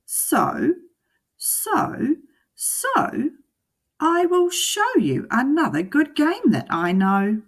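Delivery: high, loud teasing cat building up; cat in the hat. Acted. The cat announcing a new game, high and loud. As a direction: stretch the so's to a grand reveal.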